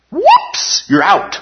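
mock squeaky whoops. Teacher mocks the idea of losing salvation, squeaky voice.

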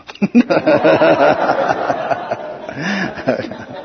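long chuckle at his own joke; long laugh. Real, not acted. Teacher joking that he is right, laughing. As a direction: laugh it out at length, letting it tail off.

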